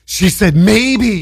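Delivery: high, drawn-out giddy gushing; giddy mock boast. Teasing a friend about all-day bragging, giddily. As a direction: draw it out like a smitten friend.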